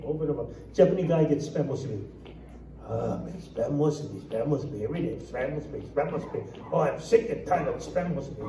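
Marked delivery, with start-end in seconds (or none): 2.8-8.5 low, soft grumble of disappointment; mock fed-up complaining, sinking under the breath; weary grumbling. Japanese worker in a joke sees the same lunch, weary grumbling.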